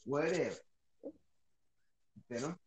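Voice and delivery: far from the mic; faint, off-mic voice; barely audible. Real, not acted. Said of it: "I can barely hear you".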